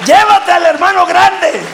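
high, sustained preaching shout; full-force preaching. Real, not acted. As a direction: cry it out to stir the congregation. (predicación a voz en cuello)

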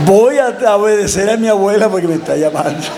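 sheepish excuse. Retold or imitated. Acts himself backing out of fight.

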